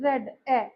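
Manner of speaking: "रेड", pause on the single "ए" sound; vowel sound picked out. Shown as a demonstration. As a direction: say "रेड", then its lone vowel "ए". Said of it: "सिर्फ ए सुनाई दे रहा है".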